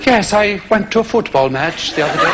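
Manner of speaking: low, affected English radio-preacher voice; Nigel, radio preacher. Acted. Nigel telling listeners about God, strange affected fashion. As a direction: put on a patronising religious broadcaster. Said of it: "in this strange fashion".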